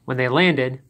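plain reading voice

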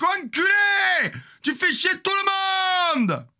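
high, loud furious yell; shouted insult. Retold or imitated. Insulting the delivery man, yelling. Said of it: "Que j'affuble d'un mot sympathique".

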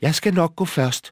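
high, eager little goat voice; plucky, high-pitched volunteer; brave little goat. A narrator's reading. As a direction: pipe up bravely as the smallest goat. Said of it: "sagde den yngste Bukkebruse modigt".

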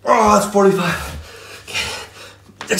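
slow, strained count; doing push ups. Real, not acted. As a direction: groan it out mid-rep.

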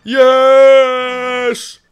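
long, high, loud "yes"; triumphant shout. Real, not acted. Gamer as he takes the lead, drawn-out triumphant shout. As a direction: hold the victory cry.

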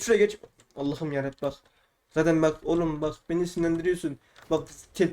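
ordinary delivery

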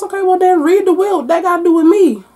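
high, loud sassy retort; mock sassy teen. Retelling Avery talking back to the lawyers, high and sassy. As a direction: snap back at them, high and incredulous.

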